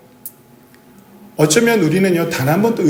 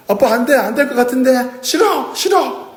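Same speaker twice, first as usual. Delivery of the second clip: put-on much higher, whiny refusing child; child throwing a tantrum; 8 semitones higher than usual. Child on a high ledge refusing to jump to father, whining tantrum. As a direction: wail no like a scared kid refusing. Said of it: "때를 쓰는 거야".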